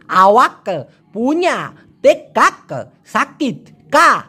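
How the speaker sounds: slow, hard Bangladeshi আওয়াক and তেকাক with every k said; Bangladeshi accent. Shown as a demonstration. Teacher mimics Bangladeshis saying the Malay phrase. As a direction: chop it like a Bangladeshi learner. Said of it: "আমাদের বাংলাদেশিদের মতো বলি".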